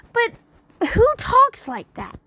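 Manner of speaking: high, slow disbelieving cry of who talks like that; exasperated high-pitched disbelief on who; incredulous. Real, not acted. Incredulous at preacher's words.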